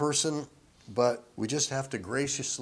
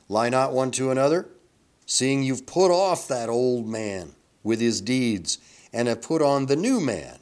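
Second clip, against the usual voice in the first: scripture reading; 6 dB louder than usual. Acted. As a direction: proclaim the verse deliberately. Reads scripture aloud.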